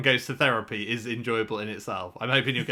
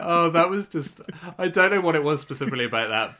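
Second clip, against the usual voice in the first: ordinary delivery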